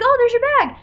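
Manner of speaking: imitating the lady at baggage claim, high and bright; chirpy stranger. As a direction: play a too-cheerful stranger. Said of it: "she's like".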